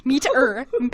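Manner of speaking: normal talking voice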